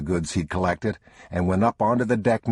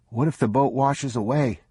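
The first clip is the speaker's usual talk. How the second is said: put-on higher, anxious child's voice; a worried small boy; young boy; 7 semitones higher than usual. A narrator's reading. Boy fretting to his father about the boat. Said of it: "the boy said".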